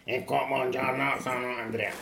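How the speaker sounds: mock machine translation voice, Tuscan-flavoured; Google Translate voice. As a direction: read it like a translation app. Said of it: "se no avrei messo Google Translate". (voce da Google Translate)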